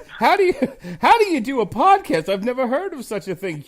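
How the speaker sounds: high, loud, hammed-up bafflement; mock astonishment. Host imitating people baffled by podcasting, mock astonishment.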